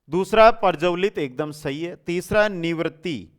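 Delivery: ordinary talk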